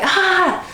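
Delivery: sharp high yelp of pain. Retold or imitated. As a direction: cry out as he presses the sore spot. Doctor presses on painful side.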